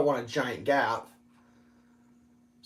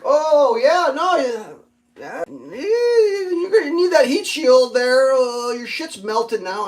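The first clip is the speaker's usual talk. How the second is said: high, slow, whiny mock warning; sing-song teasing like a smug know-it-all; mock scolding voice; 9 semitones higher, 7 dB louder, much slower than usual. Imagining a smug voice saying the shield was needed, high whiny mockery.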